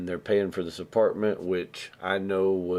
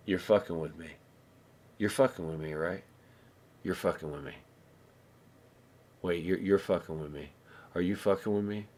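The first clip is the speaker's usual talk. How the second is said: low, soft, halting disbelief; quiet suspicion; 3 semitones lower, 6 dB quieter than usual. Retold or imitated. Stoned neighbor confronts the host, quiet suspicion. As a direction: repeat it quietly, pausing between each accusation.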